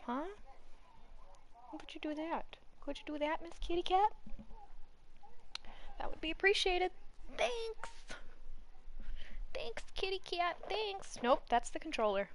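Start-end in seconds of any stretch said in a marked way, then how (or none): 1.8-11.2 much higher sing-song pet voice; high cooing thanks to the cat. Real, not acted. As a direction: coo at the kitty cat. Sweet talking the cat.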